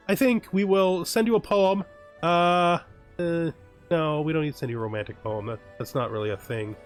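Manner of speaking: ordinary talk, nothing marked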